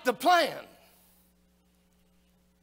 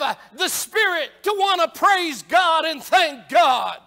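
raised, higher-pitched pulpit delivery; impassioned preaching; 6 semitones higher, 4 dB louder than usual. Real, not acted. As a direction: preach it out loud to the whole congregation. Preaches with rising force.

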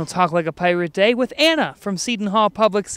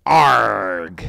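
low pirate growl; 12 semitones lower, 3 dB louder than usual. Acted. Host answers captain's pirate name.

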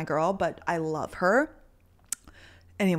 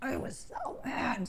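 drained; 4 semitones higher, 11 dB quieter, slower than usual. Real, not acted. After screaming, spent.

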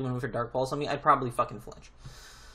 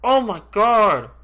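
yelling in real rage, much higher and louder; furious shout; 9 semitones higher, 11 dB louder than usual. Real, not acted. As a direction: scream it at the screen.

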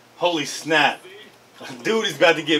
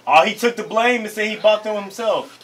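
normal talking voice

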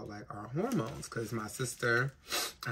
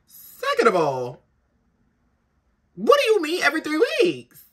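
much higher and louder, outraged disbelief; shocked disbelief; 14 dB louder than usual. Retold or imitated. Retelling to viewers her shock at his shot schedule, squealing disbelief.

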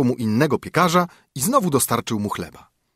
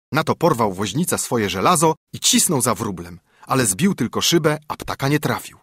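ordinary delivery, nothing marked

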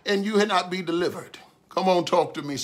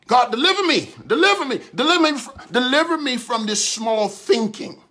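preaching at full force; 4 semitones higher than usual. Acted. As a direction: cry out each deliver me to the crowd. Preaching a prayer of deliverance.

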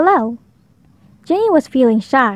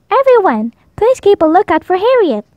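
high, raised call to the class; teacher's call; 7 semitones higher, 3 dB louder than usual. A narrator's reading. Teacher calling the class to search, raised and high. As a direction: call the whole class to attention.

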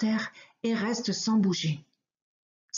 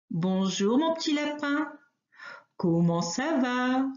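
sly wolf; 4 semitones higher than usual. Acted. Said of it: "C'est le loup".

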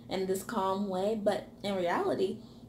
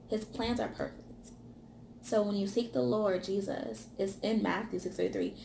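ordinary delivery

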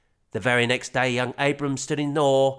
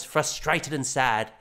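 usual speaking voice

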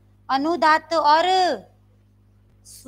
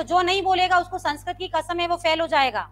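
ordinary talk, nothing marked

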